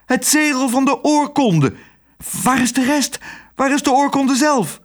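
high-pitched, urgent cries; worked-up mayor calling out; excited shouting. A narrator's reading. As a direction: fire off frantic questions at a high pitch. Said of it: "schreeuwde hij".

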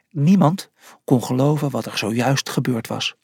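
ordinary delivery, nothing marked

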